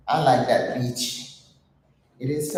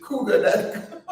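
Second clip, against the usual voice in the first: higher, fast, through laughter; laughing; 13 semitones higher, faster than usual. Real, not acted. Cracking up telling viewers about the post, laughing. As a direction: giggle the words out.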